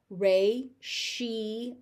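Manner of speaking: "Ratio" as three slow beats, ray, shee, oh; slow teaching model of "Ratio"; syllable by syllable. Shown as a demonstration. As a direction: break "Ratio" into separate syllables. Teacher breaks word into syllables.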